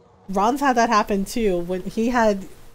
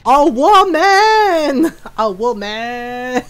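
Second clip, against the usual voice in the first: mock-grand, slowly stretched "woman"; adult voice; 6 dB louder, much slower than usual. Woman proving she's no child, hammed-up adult voice. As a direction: proclaim it slowly in an exaggerated grown-up voice. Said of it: "The adult voice".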